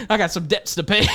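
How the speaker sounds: high, laughing Southern coach voice; runaway Southern accent. Pretend. Coach confessing casino debts to the recruit, giggly hammed-up Southern accent. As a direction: let the mock Southern twang run wild. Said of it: "I don't know what accent that was".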